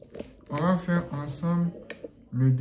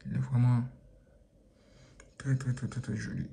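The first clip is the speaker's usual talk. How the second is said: whisper; 6 semitones lower, 8 dB quieter than usual. Real, not acted. Whispered praise.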